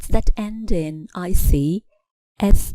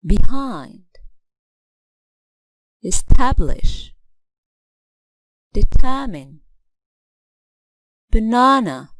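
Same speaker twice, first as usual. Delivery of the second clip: each word from Behind to Psychology alone, its stressed syllable punched; single practice words with a strong beat on the stressed syllable; model word stress; 3 semitones higher than usual. Shown as a demonstration. Teacher giving exercise answers, clear stress in each word from Behind to Psychology. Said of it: "put stress on the right syllable".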